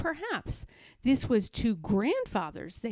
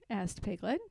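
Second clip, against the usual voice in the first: usual speaking voice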